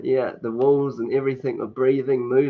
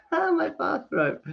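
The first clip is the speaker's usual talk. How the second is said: laughing; slower than usual. Real, not acted. Laughs about her bathrobe.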